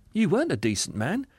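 cheerful spirit. Acted. Spirit correcting the ghost, high and kindly. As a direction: contradict him sweetly.

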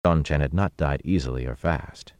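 ordinary delivery

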